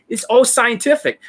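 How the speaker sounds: high-pitched mimicked scoff; mock dismissal. Coach imitating students dismissing his method, high-pitched. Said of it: "You're like".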